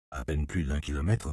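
ordinary delivery

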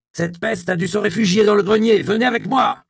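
rally the guards with a furious shout; angry order. A narrator's reading. Provost to his guards, an angry shouted order.

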